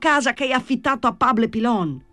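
calling out. A narrator's reading. Jesus Maria explaining to Danny which house, calling out. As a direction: call up an explanation to a window. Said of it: "gridò Gesù Maria".

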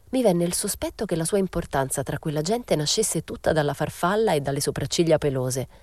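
ordinary delivery, nothing marked

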